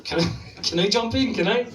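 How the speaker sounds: high, loud, insistent asking; high-pitched nagging call; pestering call. Acted. Pestering someone on the toilet.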